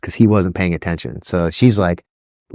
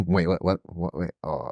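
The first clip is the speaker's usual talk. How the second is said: dazed, fading mutter; 2 semitones lower, 10 dB quieter than usual. Retold or imitated. Dad distracted, snapping back.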